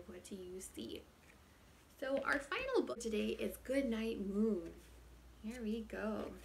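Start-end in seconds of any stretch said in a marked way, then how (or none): 0.1-1.0 whispering. Acted. Whispered title repeat.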